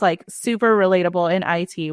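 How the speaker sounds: normal talking voice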